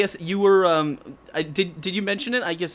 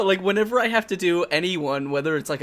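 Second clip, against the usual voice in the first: normal talking voice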